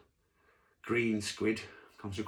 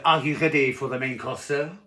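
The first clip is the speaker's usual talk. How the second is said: louder French waiter asking; French waiter accent; 4 semitones higher, 6 dB louder than usual. Acted. Waiter checks on diner's main course, French accent. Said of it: "I can't do a French accent".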